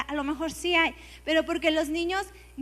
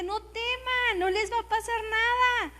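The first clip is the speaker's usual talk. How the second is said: higher, earnest call not to fear; preacher urging the people not to be afraid, higher and slower at the end; reassuring exhortation; 8 semitones higher than usual. Retold or imitated. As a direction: lift them out of their fear. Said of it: "yo le digo a la gente".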